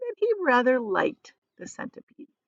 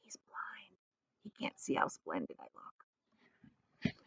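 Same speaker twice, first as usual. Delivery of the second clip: whisper; 17 dB quieter, slower than usual. A narrator's reading. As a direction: confide it behind the earthworm's back. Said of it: "whispering in James' ear".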